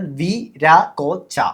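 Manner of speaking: വി രാ കോ ച said slowly, each syllable separate; വി രാ കോ ച as four separate beats. Shown as a demonstration. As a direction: count out വി രാ കോ ച one beat at a time. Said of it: "four syllables".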